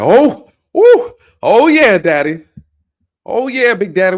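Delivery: loud, high mock moans of pleasure; mocking the officer's excitement, loud and high; mock excited moaning. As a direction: ham up her thrill, loud and high. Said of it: "She's excited".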